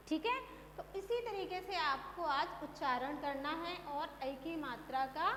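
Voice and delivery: ordinary delivery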